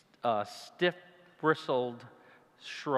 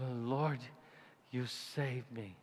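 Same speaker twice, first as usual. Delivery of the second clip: grateful prayer; 8 dB quieter, slower than usual. Retold or imitated. Grateful prayer after the leap.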